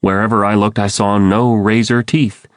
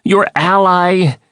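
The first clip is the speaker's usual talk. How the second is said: high, drawn-out appeal; urgent cry; 10 semitones higher, slower than usual. A narrator's reading. Andalite appeals to the Lyrans as friend, crying out urgently. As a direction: call out high, desperate to be recognized. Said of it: "I cried".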